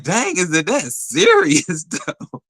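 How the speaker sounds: high, slow dang with a laugh; amused disbelief. Retold or imitated. Teasing her friend about his denial, laughing and high. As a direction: laugh through the question. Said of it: "I was like".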